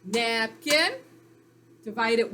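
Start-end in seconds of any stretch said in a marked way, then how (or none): 0.0-0.9 NAPKIN louder, in two even beats, rising at the end; clapped-out syllables. Shown as a demonstration. Teacher claps out the word for pupils, syllable by syllable. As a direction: stress NAP and KIN as separate syllables. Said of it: "Clap it out".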